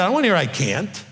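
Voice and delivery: normal talking voice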